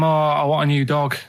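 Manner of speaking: ordinary delivery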